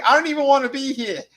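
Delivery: high, loud whiny quote; high-pitched mocking whine; mocking the blonde. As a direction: squeal it like a bored girl. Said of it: "the blonde's like".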